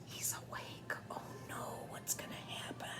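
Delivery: hushed suspense whisper; whispered worry to a little one. Acted. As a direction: breathe it like a secret. Suspense for grandchild.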